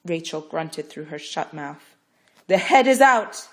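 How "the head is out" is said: loud, slow announcement; proclaiming. Acted. Midwife proclaiming the head is out. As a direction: declare it triumphantly. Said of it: "Yima proclaimed".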